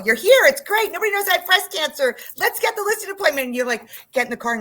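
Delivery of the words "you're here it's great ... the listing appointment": much higher, louder bright sales cheer; chirpy, upbeat client-facing show voice; putting on a show. Retold or imitated. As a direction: fake brightness to hide being ill. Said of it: "you put on a show".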